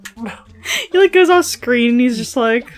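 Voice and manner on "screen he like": ordinary delivery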